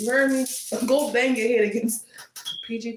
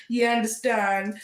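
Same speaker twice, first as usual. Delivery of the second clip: ordinary talk, nothing marked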